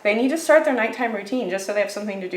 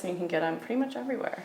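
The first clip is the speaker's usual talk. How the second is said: talking fast; 2 semitones lower, 10 dB quieter, much faster than usual. Real, not acted. Vlogger hurrying through her food haul, talking fast. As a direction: rattle it off softly and quickly. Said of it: "I feel like I'm talking fast".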